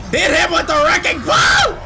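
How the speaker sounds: shout. Real, not acted. Gamer yelling at Ashley to use the wrecking ball, shouting. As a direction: yell it over the gunfire. Urging teammate during firefight.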